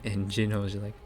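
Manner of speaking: ordinary delivery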